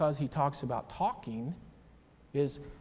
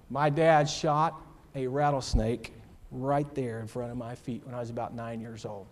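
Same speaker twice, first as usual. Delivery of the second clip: normal talking voice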